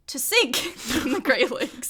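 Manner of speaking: laughing through the words. Real, not acted. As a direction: giggle as you say it. Laughing at first sinking.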